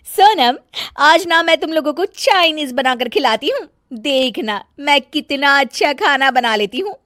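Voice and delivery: high, loud complaining bahu voice; bossy bahu fussing over nothing; nagging daughter-in-law. Acted. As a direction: scold the whole family, shrill with irritation. Megha brags about cooking.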